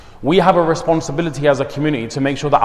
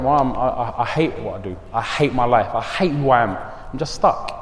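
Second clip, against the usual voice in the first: retold crying; 4 semitones lower, 5 dB quieter than usual. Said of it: "He started shedding tears and crying".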